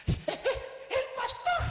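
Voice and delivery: tiny high gasped-out words; hammed-up breathless fright; mock trembling. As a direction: squeak it out, out of breath. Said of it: "Y llegó corriendo, temblando".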